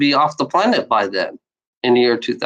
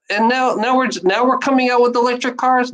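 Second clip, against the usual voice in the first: higher-pitched complaint; exasperated disbelief; 8 semitones higher than usual. Real, not acted. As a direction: grumble in disbelief, pitch raised. First take of incredulous complaint.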